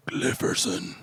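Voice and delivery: soft, whispered demon; hushed demonic whisper. Shown as a demonstration. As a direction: breathe the name out as a demon. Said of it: "like, a demon voice".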